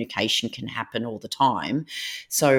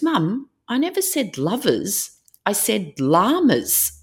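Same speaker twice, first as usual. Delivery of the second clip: lightly voiced exasperated daughter; 9 semitones higher, slower than usual. Daughter correcting mum.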